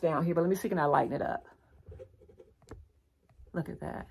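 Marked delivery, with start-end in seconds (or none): 3.5-4.1 quiet awed aside; soft hushed whisper. Real, not acted. Vlogger showing viewers a close-up, whispered awe. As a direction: breathe it out softly.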